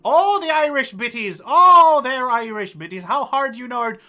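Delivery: high, loud, hammed-up Irish accent; terrible Irish accent. Host thanking stream viewers by name, in a hammed-up Irish accent. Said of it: "it's my terrible Irish accent".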